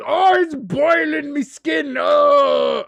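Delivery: high, slow, shouted wail; mock overheated European. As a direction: howl as if being boiled alive, hammed-up. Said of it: "I understand why they would see".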